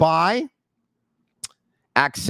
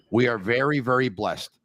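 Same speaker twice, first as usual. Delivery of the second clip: ordinary talk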